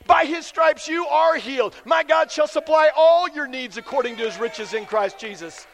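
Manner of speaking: loud, rising scripture declaration; fervent preaching. Acted. Friend reminding a struggling man, loud fervent declaration. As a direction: speak the promise over him with fire.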